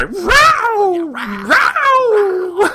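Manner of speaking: high, loud mock big-cat roar; jaguar cry. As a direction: let out a hammed-up jaguar yowl. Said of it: "another Jaguar cry".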